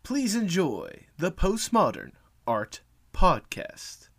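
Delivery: slow, drawn-out showman announcement; grand announcer. Acted. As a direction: present it like a ringmaster. Announcer presenting the episode.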